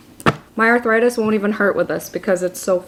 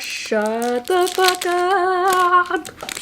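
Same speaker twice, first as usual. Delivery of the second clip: excited disbelief; 8 semitones higher, much slower than usual. Real, not acted.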